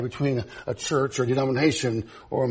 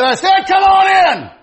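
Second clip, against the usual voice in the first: high, drawn-out shout, imitating grandma; full-blast grandma yell; exasperated holler; 11 dB louder than usual. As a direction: holler it louder, insisting. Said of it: "Grandma hollered".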